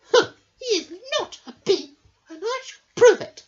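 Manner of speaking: high, fast, pert little bee; clever little bee. Acted. Amelia the clever bee quizzes Norman, high and quick. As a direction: question him like a sharp little know-all. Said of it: "said Amelia".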